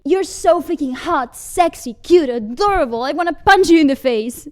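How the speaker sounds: high, loud, overexcited adoration; aggressive gushing. Acted. Gushing aggressively at returning boyfriend.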